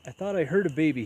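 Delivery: startled museum owner calling out loud; loud cry. A narrator's reading. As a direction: burst in calling out. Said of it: "he cried".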